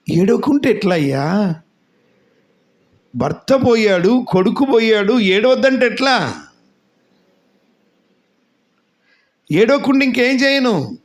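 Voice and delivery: grieving protest. Retold or imitated.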